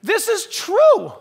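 loud, slow and high defiance; preacher's loud refusal to back down; defiant declaration. Real, not acted. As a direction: plant your feet and declare it. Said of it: "church is not gonna grow".

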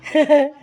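loud, high chuckle; delighted laugh. Real, not acted. Vlogger watching the goat start eating, laughing. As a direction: burst into a brief high laugh.

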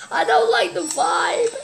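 ordinary talk, nothing marked

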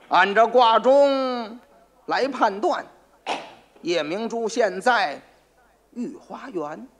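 very slow, drawn-out oracle; solemn pronouncement. Acted. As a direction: spell it out with mock gravity. 老黄故作玄虚宣布卦象. (故弄玄虚，一字一顿)

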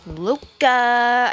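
put-on playful Italian accent on "Luca", light and sing-song. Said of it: "I like saying Luca in an Italian accent".

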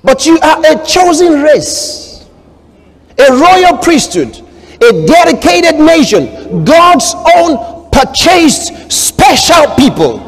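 fervent preacher reading aloud; preaching scripture. Acted. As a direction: proclaim it slowly, building to a shout.

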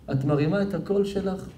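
ordinary delivery

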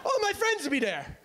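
high, bright-pitched insistence; high cheerful Irish girl pushing a group drink; chirpy Irish girl. Retold or imitated. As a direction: dismiss him then sell the night out.

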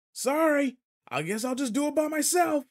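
high, loud apology called across the field; loud call to a far-off teacher. Acted. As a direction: shout the excuse to the teacher. Still calling to distant teacher.

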